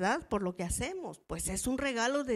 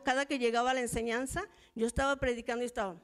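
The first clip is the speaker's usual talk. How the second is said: normal talking voice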